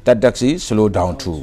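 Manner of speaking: very slow, word by word textbook reading; slow read-aloud. Shown as a demonstration. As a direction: spell out each word slowly for the class. Teacher reads textbook sentence aloud.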